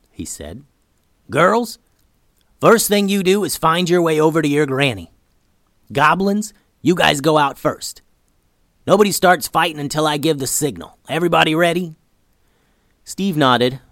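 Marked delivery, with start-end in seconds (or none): 1.3-12.0 loud, high rallying orders; rally the troops, calling out; commanding call. Acted.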